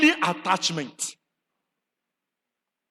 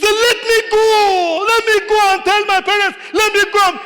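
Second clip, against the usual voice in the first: pleading would-be disciple; 10 semitones higher, 6 dB louder than usual. Acted. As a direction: ham up an eager follower begging leave. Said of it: "He said".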